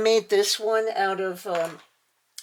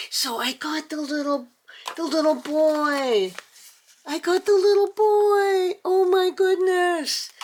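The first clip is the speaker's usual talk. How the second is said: thrilled squealing; 10 semitones higher, 3 dB louder than usual. Real, not acted. Telling viewers she got the little boy, squealing higher and louder. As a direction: let the delight spill over.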